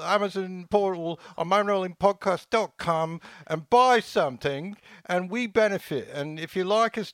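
put-on high English ad-read accent; David Beckham voice. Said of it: "this is my David Beckham voice".

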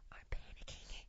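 hushed, urgent whisper; a frantic secret whisper to your wife; panicked whisper. Retold or imitated. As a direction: hiss it so the chef won't hear. Frightened aside to wife at bar.

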